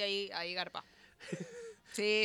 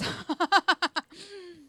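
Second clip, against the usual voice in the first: laughing; 8 semitones higher, 5 dB louder than usual. Real, not acted. Laughs at own chips remark.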